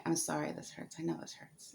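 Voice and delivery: soft, near-whispered comforting apology; gentle nurse apology. Retold or imitated. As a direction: murmur it gently to a patient in pain. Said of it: "very gentle with me and very kind".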